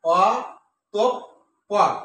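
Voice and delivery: অতঃপর very slowly in three beats, with pauses; অতঃপর pulled wide apart for the class; split into syllables. Shown as a demonstration. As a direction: give each syllable of অতঃপর on its own. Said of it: "অটা হচ্ছে মুক্তাক্ষর".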